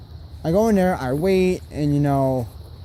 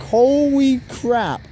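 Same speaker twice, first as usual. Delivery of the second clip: excited disbelief; 9 semitones higher, slower than usual. Real, not acted. Excited about passing permit test.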